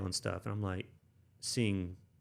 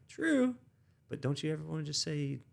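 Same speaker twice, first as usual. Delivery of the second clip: mock pleading pitch; 6 semitones higher than usual. Coaxing her, high retold plea.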